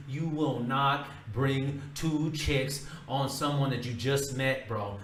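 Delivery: ordinary delivery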